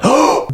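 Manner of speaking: sharp high intake of breath; shocked gasp. Real, not acted. Reader reacting to the resuscitation twist, sharp gasp. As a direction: gasp at a plot reveal.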